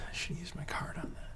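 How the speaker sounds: barely audible regretful whisper; whispered aside. Real, not acted. Player regrets an unused card, whispered aside. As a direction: whisper it under your breath, quickly.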